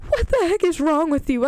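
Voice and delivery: hammed-up angry wife; mock angry Pepper. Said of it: "she's like".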